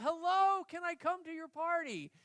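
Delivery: high loud call across the river; bunny calling out to the beaver dam, loud and high; hollering. Retold or imitated. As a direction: shout out to the beavers. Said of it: "he hollered out".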